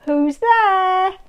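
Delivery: plain reading voice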